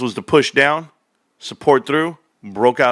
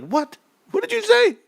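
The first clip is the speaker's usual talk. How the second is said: squeaky, much higher what-did-you-say; shocked disbelief. Retold or imitated. Reacting to brother's confession, squeaky shocked disbelief. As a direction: shriek it in disbelief.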